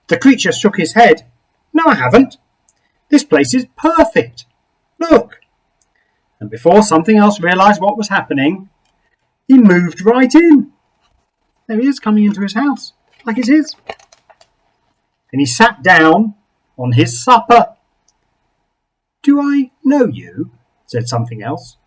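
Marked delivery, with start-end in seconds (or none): 1.7-5.4 cheery creature. Acted. Creature greets Something Else at his door, high and cheery. 19.2-20.5 puzzled. Acted.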